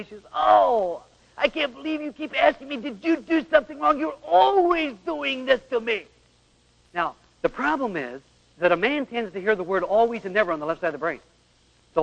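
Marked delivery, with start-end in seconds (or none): 0.3-6.1 high, slowing complaint; exasperated wife. Retold or imitated. Wife fed up with husband's questions, high and whiny. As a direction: whine it at him, stretching the end.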